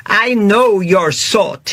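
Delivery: put-on menacing owner in Italian accent; thick Italian accent. Owner sizing up rude diners, Italian accent. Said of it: "thick Italian accent".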